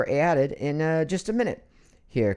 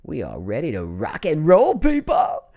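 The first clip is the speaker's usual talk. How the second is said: hyped show opening; 4 dB louder than usual. Real, not acted. Host kicks off the stream for viewers, hyped. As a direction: fire up the crowd, punching the end.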